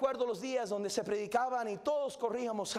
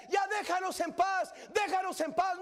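the preacher voicing the tepid church, shouting; shouted mock plea; 6 semitones higher than usual. Voicing the lukewarm church again.

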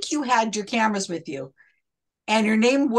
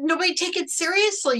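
ordinary talk

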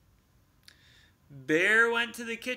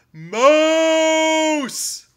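very loud bellow; shouting; 12 semitones higher, 16 dB louder than usual. A narrator's reading. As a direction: shout across the house. Bear shouts for Mouse.

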